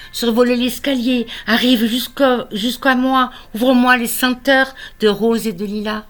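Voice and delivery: impassioned poetry recital. Acted. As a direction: call out to the beloved in verse. Love poem builds in appeal. (poème d'amour déclamé avec ferveur)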